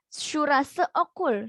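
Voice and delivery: "Surase okul" said very slowly and clearly; drawn out for students to write down; slow classroom dictation. Shown as a demonstration. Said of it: "dictating the example slowly".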